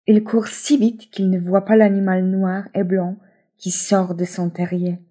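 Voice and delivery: ordinary delivery, nothing marked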